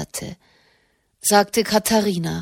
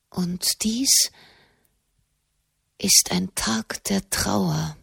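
mournful; slower than usual. Acted. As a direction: draw out each word in grief. Katharina trauert. (langsam, trauernd)

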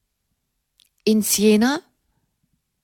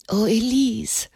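jammernd; 2 semitones higher than usual. A narrator's reading. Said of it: "jammerte Blanchefleur".

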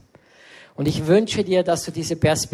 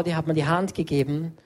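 ordinary talk, nothing marked

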